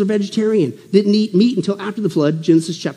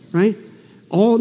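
normal talking voice

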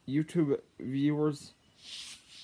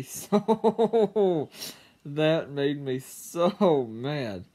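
very slow, louder words broken by laughter; laughing while telling; 6 dB louder, much slower than usual. Real, not acted. Telling viewers how mad he got, laughing through it. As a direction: try to say you were mad but can't stop laughing. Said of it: "I can't help laughing".

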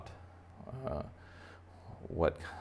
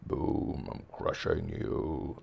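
stomping monster bunny; 4 dB quieter, much slower than usual. Acted. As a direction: stretch the words as if stomping. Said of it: "his feet to be like".

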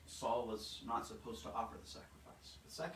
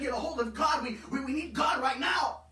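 full-force shouted plea, much higher and louder; preaching shout; 13 semitones higher, 13 dB louder than usual. Real, not acted. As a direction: cry it out to the congregation.

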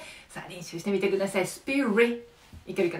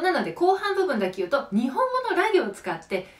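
ordinary delivery

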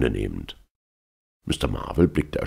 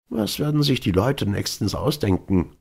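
higher-pitched, mildly timid question; 7 semitones higher than usual. A narrator's reading. Marvel asks about the newspaper story, timidly. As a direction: ask it hesitantly, pitched up. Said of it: "fragte er zaghaft".

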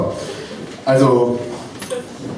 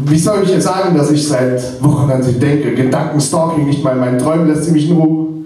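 higher-pitched, urgent verse delivery; slam poem recital; 5 semitones higher than usual. Acted. Poet who can't tell her his feelings, urgent recital. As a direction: recite it as a mounting outpouring.

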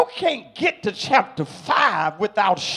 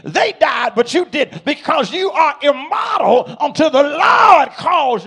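loud, raised preacher's cry; full-force preaching; 4 semitones higher, 8 dB louder than usual. Real, not acted. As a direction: drive each example home, shouting to the congregation.

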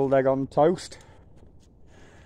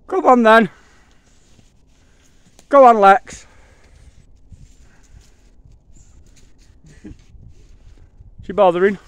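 loud high calling; 11 semitones higher, 10 dB louder than usual. Real, not acted.

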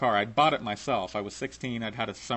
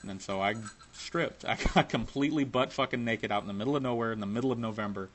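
ordinary talk

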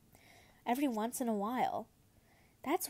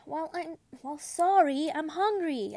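higher, whiny complaint; complaining; 5 semitones higher, 4 dB louder than usual. A narrator's reading. Son complaining to his father about being hungry. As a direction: grumble back at the father. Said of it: "complained to him".

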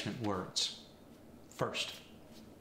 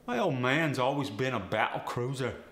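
cockney accent; 4 dB louder than usual. Shown as a demonstration. Example sentence in Cockney slang.

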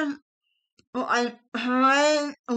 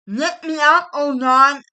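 loud, higher plea to God; loud prayer; 4 semitones higher, 8 dB louder than usual. Retold or imitated. Woman retells praying to God from bed, loud plea. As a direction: cry out to God for help. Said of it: "I told him".

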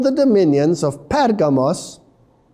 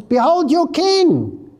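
"king" stressed, much higher and slow; emphasis on king; 9 semitones higher, slower than usual. Acted. Pilate presenting Jesus to the crowd, stress on "king". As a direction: lean hard on "king", lifting it high. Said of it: "Can you see the emphasis on the king?".

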